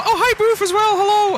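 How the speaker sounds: high-pitched streamer welcome; excited greeting. Real, not acted. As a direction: greet a friend with a burst of joy. Greeting a raider.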